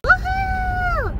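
high, drawn-out whoop; excited whoop. Real, not acted. Cheering on the road trip, excited whoop. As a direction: let out a long, high woohoo.

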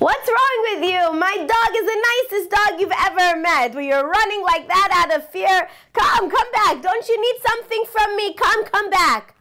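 high, insistent call in imitation; calling after someone. Dog owner to the man running out of fear, calling after him. Host calls after fleeing visitor.

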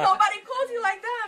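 high, loud, laughing through the words. Real, not acted. Woman recalling an unexpected phone call, laughing. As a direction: crack up as you say it.

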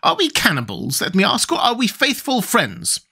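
reproachful questions climbing high; high, shocked character voice. A narrator's reading. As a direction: challenge them as an appalled tin man. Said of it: "greatly shocked".

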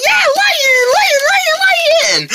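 high-pitched, drawn-out squeal of excitement; excited cheering. Acted. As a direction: cheer the word out long and high. Puppet cat excitedly chanting for lions.

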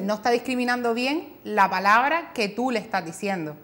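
ordinary talk

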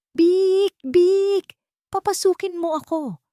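hammed-up hungry wolf demanding entry; wolf shouting. The wolf shouting at Toti to let him in, loud and high. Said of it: "at sumigaw".

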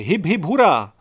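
loud hip hip hooray; cheer. Acted. Gajdošík raising the toast to classmates, loud cheer. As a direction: lead the cheer.

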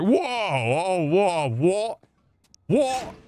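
high, swooping exaggerated whoas; mock shocked reaction. Imitating people reacting to a clip, mock astonishment. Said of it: "people are gonna be like".

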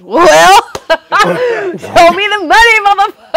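high, loud, stretched-out crow of triumph; gloating, laughing shout. Retold or imitated. Gloating comeback to producers, laughing.

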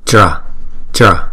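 "tra" as one tight syllable; "tra" in one beat; single syllable tra. Shown as a demonstration. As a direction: say "tra" as one sound, no vowel between t and r. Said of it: "âm thứ nhì là".

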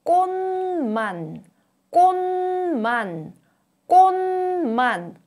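꽃만 said as kkonman, nasal n; 꽃만 with ㅊ turned to n; exaggerated word endings. Shown as a demonstration. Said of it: "is pronounced as n, as in".

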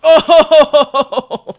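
loud high burst; startled yell; surprised shout. Real, not acted. Sudden kill moment.

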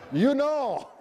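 laughing through the words. Real, not acted. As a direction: chuckle it out. Laughing at poor Warren.

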